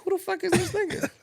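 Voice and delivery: high, baffled demand; who-is-this-guy disbelief; mock-shocked squeal; incredulous. Retelling his baffled reaction.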